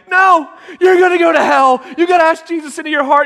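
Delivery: high, loud, hurried pleading; hammed-up childish alarm; frantic retold yell. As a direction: shout urgently like a panicked kid. Panicked child urging brother to convert.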